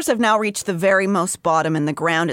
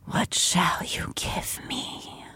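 soft echoing dream voice; 9 dB quieter, slower than usual. Acted. The creature in the dark.

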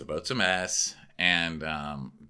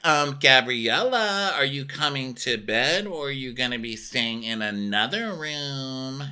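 mock passive-aggressive; 6 semitones higher than usual. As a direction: imitate a nosy, sing-song needling roommate. Acting Ashley's pointed question.

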